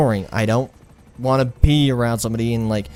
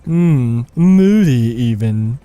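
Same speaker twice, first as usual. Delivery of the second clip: louder, drawn-out cartoon cat voice; pink tiger; 5 dB louder, much slower than usual. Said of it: "I sound like that one little tiger, that pink tiger".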